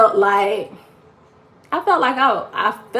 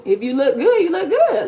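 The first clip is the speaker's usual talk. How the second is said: laughing; 7 semitones higher than usual. Real, not acted. Laughing while speaking.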